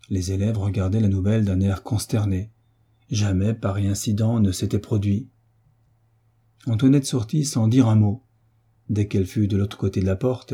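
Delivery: ordinary delivery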